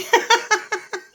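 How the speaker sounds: squeaky falling giggle; high giggle. Real, not acted. Vlogger still laughing at the yacht line, high giggle. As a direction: let a high giggle slip out. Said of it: "I'm in a giggly mood".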